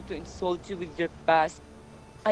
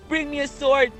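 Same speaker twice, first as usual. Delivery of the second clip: higher, mildly shouted quote; imitated shout; 7 semitones higher than usual. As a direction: voice the king's frantic demand. Messenger voices Oedipus shouting.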